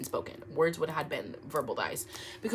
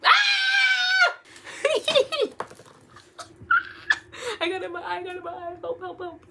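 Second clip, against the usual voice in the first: shrieking far higher, laughing in fright; real scream; 13 semitones higher, 6 dB louder, faster than usual. Real, not acted. Startled when her dad walks in, screaming. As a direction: scream then squeal for help.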